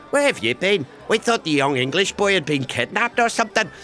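put-on Belfast accent. Mates ask where the English boy went, Belfast accent. Belfast locals greet the returning comic.

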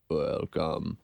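deep, slow Freud-like voice; German psychologist voice. Acted. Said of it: "a German psychologist".

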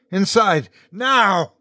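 high-pitched urgent command; urgent yell. A narrator's reading. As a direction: yell the order in a panic. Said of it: "I yelled".

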